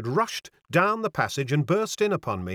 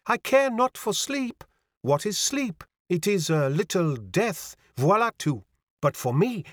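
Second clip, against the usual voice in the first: put-on lilting, higher French-accented girl; French young lady, pitched up; mincing French accent; 7 semitones higher than usual. A narrator's reading. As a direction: declare it airily with a French accent. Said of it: "with a touch of the mincing French accent".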